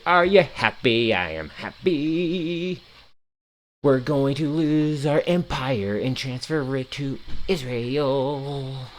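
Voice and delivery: slow, drawn-out mock happiness; sarcastic singsong. Streamer pretending to be happy about the war, sarcastic. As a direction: stretch the words into a sarcastic little chant. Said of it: "sarcastic there".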